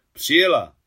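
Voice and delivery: forceful, military confirmation; commanding reply. A narrator's reading. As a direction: answer the soldier with loud authority. Officer answers the courier commandingly.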